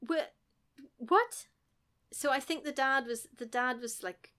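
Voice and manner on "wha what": ordinary delivery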